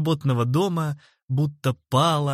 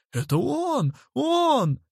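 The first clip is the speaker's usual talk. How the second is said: very high, panicky squeal; snivelling; 12 semitones higher, slower than usual. A narrator's reading. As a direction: pass the blame in a panic. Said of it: "хлюпнул тот". (испуганно и визгливо)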